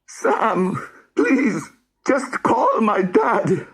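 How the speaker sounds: high, slow tearful begging; crying plea. Acted. Rory crying as he begs Sam to call his dad. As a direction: beg him through tears.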